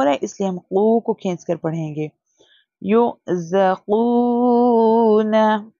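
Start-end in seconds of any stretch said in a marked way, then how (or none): 2.8-5.7 क़ू held long on the long vowel, slow recitation; stretched qu. Shown as a demonstration. Teacher showing students how to stretch क़ू. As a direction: draw out क़ू in युग ज़ क़ू ना as a long oo. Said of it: "We have to stretch the क़ू".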